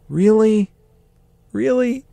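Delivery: squeaky high, drawn-out question; pained disbelief. Retold or imitated. Exhausted worker tempted by a Bowie job, high pained disbelief. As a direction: voice shoots way up in disbelief.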